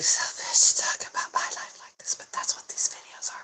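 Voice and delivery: soft whispered apology. Real, not acted. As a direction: breathe the words, barely voiced. Whispered apology ending emotional video.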